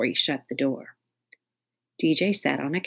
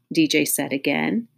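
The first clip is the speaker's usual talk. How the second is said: ordinary delivery, nothing marked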